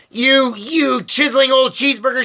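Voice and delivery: high angry yell; shouted. A narrator's reading. Grandma yelling an insult at Mister Wonka, high. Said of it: "she shouted".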